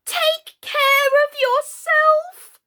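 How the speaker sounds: very high, loud, slow scream; screaming warning. A narrator's reading. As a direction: shriek a frantic warning.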